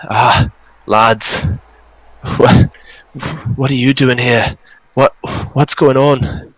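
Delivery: put-on lightly done northern Scottish accent, dazed; a revived dwarf in a northern Scottish accent, confused. GM voices Gundren to the party in a northern Scottish accent. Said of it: "his northern Scottish accent".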